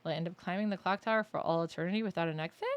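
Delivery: ordinary talk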